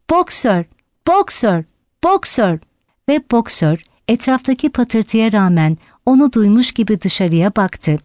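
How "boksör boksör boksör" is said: understated repeated call, falling each time; crying out. A narrator's reading. Yonca running after Boxer, mildly voiced cries. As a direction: call the name urgently without much volume. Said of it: "diye bağırdı".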